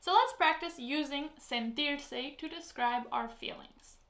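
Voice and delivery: normal talking voice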